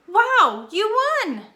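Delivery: loud, high thrilled cry; excited exclamation. Shown as a demonstration. Teacher demonstrating an exclamatory sentence, excited. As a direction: burst out with delight. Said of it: "strong emotion".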